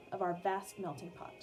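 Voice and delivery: usual speaking voice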